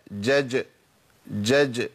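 "جج" as open jeem, then still jeem with a bounce; qalqalah on jeem. Shown as a demonstration. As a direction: release the second jeem of "جج" into a short echo. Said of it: "مع تحقيق القلقلة".

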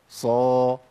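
"صا" with a heavy, dark s and backed vowel; emphatic sad sound. Shown as a demonstration. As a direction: sound out a full-mouthed "صا". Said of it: "غير الصاد أقول إيه؟".

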